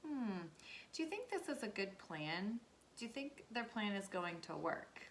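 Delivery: ordinary talk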